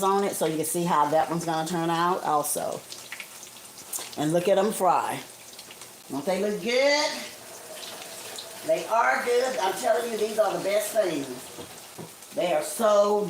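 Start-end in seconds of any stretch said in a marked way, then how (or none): none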